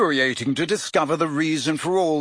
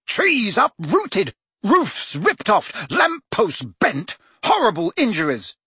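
slow, higher, each item hammered; angry listing; 7 semitones higher, slower than usual. A narrator's reading. Prime Minister lists the damage at Fudge, slow and angry.

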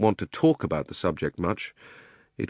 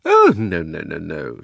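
shocked; 3 semitones lower, 6 dB louder, slower than usual. Acted. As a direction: protest in mock shock. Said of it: "in a shocked voice".

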